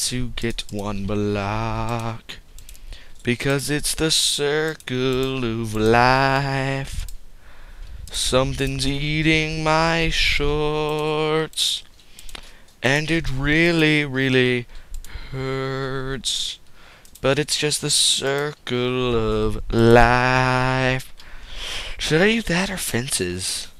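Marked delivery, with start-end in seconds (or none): 3.2-7.1 slow, grand mock-profound delivery; mock solemn. Vlogger mock-philosophizing about the story, slow and solemn. 17.2-21.1 slow, grand mock-profound delivery; mock solemn. Vlogger mock-philosophizing about the story, slow and solemn.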